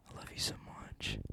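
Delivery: breathy unvoiced whisper of love. Whispering love to the listeners. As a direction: whisper it close, like a secret.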